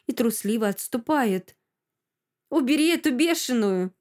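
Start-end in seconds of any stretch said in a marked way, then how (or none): 2.5-3.9 higher-pitched, restrained cry for help; mild yell. A narrator's reading. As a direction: call out in mild alarm. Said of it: "кричит".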